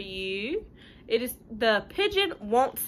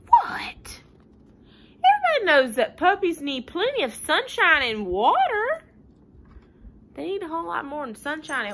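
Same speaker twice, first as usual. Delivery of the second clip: indignant pigeon; 6 semitones higher, 7 dB louder than usual. Acted.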